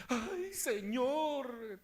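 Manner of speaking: very slow, high wail of recognition; acted tearful cry. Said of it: "Hasta que ella".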